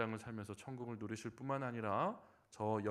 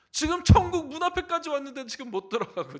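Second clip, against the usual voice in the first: much higher, much louder outburst; frustrated shout; 17 dB louder than usual. Retold or imitated. Preacher voicing the revived deaconess, angry at missing heaven, shouting. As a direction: cry it out in anguished frustration.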